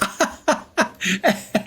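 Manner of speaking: high staccato laugh. Real, not acted. Gamer amused by own Russian pun, laughing. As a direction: chuckle in a quick, high burst.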